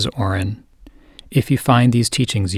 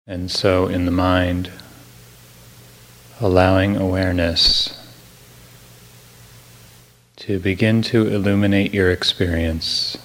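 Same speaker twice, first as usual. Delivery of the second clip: guided meditation; 2 semitones lower than usual. Real, not acted. Teacher easing a listener into the morning, calm guided meditation. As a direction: guide gently, unhurried, phrase by phrase.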